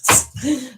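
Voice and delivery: short, helpless chuckle; real amused laugh. Real, not acted. Reader apologises for laughing, amused chuckle. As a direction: let a giggle slip out. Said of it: "Sorry, I'll just laugh".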